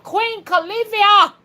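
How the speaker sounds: high, loud, slow cry of "Queen Califia"; rallying the chat to shout her name. Shown as a demonstration. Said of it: "and shout".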